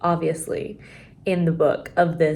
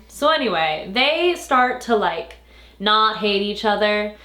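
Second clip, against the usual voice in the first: normal talking voice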